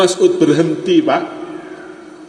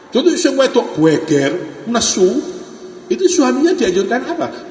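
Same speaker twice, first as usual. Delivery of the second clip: high, amused and teasing; laughing; 10 semitones higher than usual. Real, not acted. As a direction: hold back a laugh as you tell it. Joke about angry wife.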